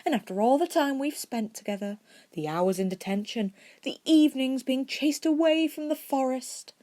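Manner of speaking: high, whiny mock hurt; lightly pouting twin teasing Harry. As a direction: play the wounded friend, pouting. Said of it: "said with a pout".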